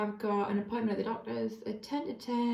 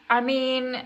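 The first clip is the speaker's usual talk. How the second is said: excited; 3 semitones higher, 7 dB louder than usual. Real, not acted. Gushing over [name]'s hair.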